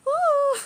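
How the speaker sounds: loud, high sung "woo"; high whoop. Real, not acted. Sung high note, then laughing.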